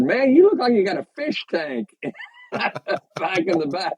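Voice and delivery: high-pitched mock exclamation breaking into laughter; amused onlooker. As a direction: call it out high, then crack up. Acted onlooker's quote, breaking into laughter.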